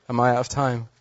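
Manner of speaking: ordinary talk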